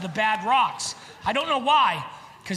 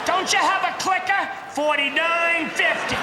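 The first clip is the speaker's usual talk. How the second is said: higher, louder indignant yell; indignant shout; 7 semitones higher, 4 dB louder than usual. Retold or imitated. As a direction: shout it at the ferry clerk. Retold argument with ferry operator.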